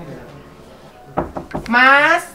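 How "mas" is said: high, loud drawn-out teasing plea; teasing call. Pretend. Host teasing the housemate in the shower, playful call. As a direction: coax playfully through the door.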